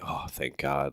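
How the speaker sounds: very soft, low sigh of relief; hushed relief. Retold or imitated. Drunk teen alone at home, hushed relief. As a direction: breathe it out quietly, relieved.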